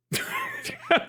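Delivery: sudden high giggle; a real burst of high laughter; high squeaky laugh. Real, not acted. Laugh after the joke.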